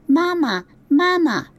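put-on very high, tiny baby chirp; high, tiny hatchling cry for mama; gosling egg voice. A narrator's reading. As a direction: call like a newborn chick. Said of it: "said the egg".

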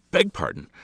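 haughty lady. A narrator's reading. After cough.

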